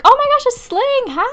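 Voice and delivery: high, loud squeal of delight; thrilled, loud surprise hello; excited greeting. Real, not acted. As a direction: burst out greeting a friend, very high. Spots and greets an arriving friend.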